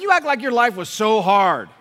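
loud, high teasing rebuke; shouting at his son in mock disbelief; mock exasperated shout. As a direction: tease him with a big mock outburst. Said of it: "I said".

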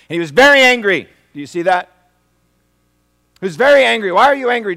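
loud, high preaching cry; shouting the key word very. Real, not acted. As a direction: drive the point home at full force. Preaching at full force.

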